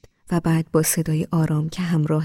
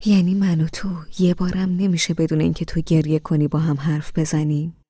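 usual speaking voice

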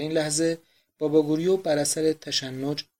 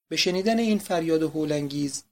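usual speaking voice

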